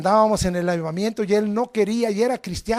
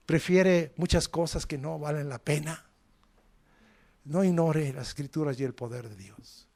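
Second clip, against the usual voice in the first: prédica suave; 3 semitones lower, 7 dB quieter than usual. Acted. Predica en tono bajo e intenso.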